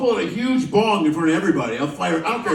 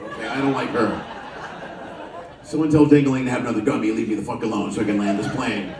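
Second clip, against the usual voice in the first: annoyed pilot; 8 semitones lower than usual. Retold or imitated. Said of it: "You say".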